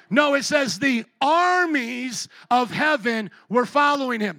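loud, slow, preaching force; emphatic shout. Acted. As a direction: drive the correction home loudly.

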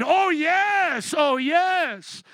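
high, loud triumphant cheer; gleeful cheer. Pretend. Preacher acting the rejoicing church, loud gleeful cheer. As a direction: crow over the fallen side.

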